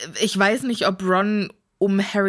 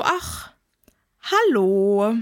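mock casual greeting; 2 semitones lower, much slower than usual. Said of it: "so hättest du das ausgesprochen".

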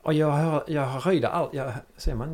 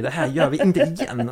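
louder, higher angry warning; 4 semitones higher, 6 dB louder than usual. Retold or imitated. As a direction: lay down the law, furious. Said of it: "kom någonting väldigt arg".

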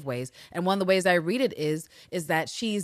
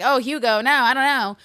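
louder, higher mock dismissal; dismissive Eve; 5 semitones higher, 6 dB louder than usual. Eve rejecting Hugo as bagman, mock dismissive. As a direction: wave Hugo off. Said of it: "And she's like".

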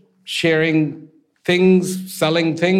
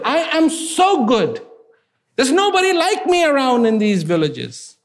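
high pompous boast; 8 semitones higher than usual. Acted. Archer lording it over the oil seller, high and proud. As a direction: puff up like a vain champion.

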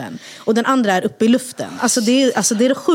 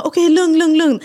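louder, higher reassuring calls; calming the kids; 8 semitones higher, 5 dB louder than usual. Retold or imitated. Mother in the stuck lift calms her children, loud and reassuring. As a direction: hush the scared children urgently. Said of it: "jag bara".

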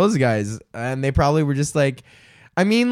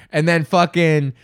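ordinary talk, nothing marked

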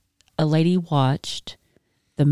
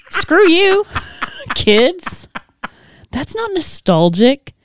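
high indignant squeal; outraged teacher, high and squeaky; mock outrage; 14 semitones higher, much slower than usual. Mock outrage at students' choice.

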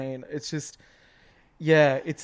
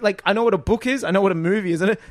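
usual speaking voice

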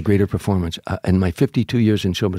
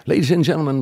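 emcee announcing; 7 semitones higher than usual. Retold or imitated. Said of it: "The M C gets on stage".

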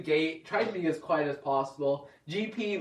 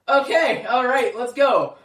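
higher, louder, fed-up "okay, let's go"; impatient relief; 6 semitones higher, 7 dB louder, slower than usual. Retold or imitated. Late for the baptism, urging the taxi on, impatiently loud. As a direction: hurry a late taxi along.

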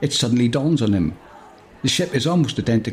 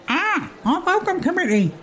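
very high, squeaky hologram voice; high-pitched chirpy hologram; Bob's voice. Acted. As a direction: pipe it up like a tiny robot. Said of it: "says Bob".

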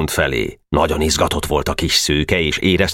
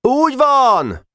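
high, drawn-out crowd shout; roared agreement; slower than usual. A narrator's reading. Assembly backing the president, roaring agreement high. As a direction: chant assent like a rowdy assembly. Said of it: "harsogta a közgyűlés".

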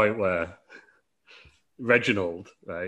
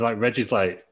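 ordinary delivery

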